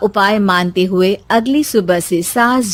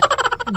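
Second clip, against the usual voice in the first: laugh; 13 semitones higher, 7 dB quieter than usual. Acted. Narrator laughs at own joke.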